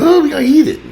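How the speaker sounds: children squealing over cereal in mock delight; imitating little kids. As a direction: mimic greedy little kids spotting cereal. Voicing kids grabbing cereal.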